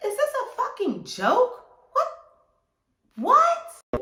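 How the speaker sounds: very high, squeaky disbelief; incredulous outrage. Retold or imitated. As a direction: shriek it, building to a loud final WHAT. Outraged reaction to lewd demand.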